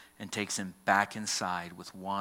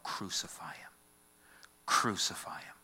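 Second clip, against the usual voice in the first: whispered, hollow chant of the mob; a preacher whispering the mob's demand; hushed crowd cry. Retold or imitated. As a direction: let the crowd's cry come out as a chilling whisper. Crowd's cry retold in hush.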